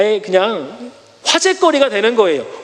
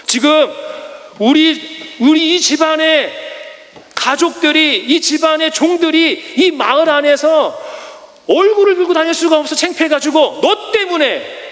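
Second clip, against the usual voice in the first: angry scolding; 7 semitones higher than usual. Acted. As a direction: berate him for the family's shame. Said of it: "이렇게 해야 되는 것이 맞거든요".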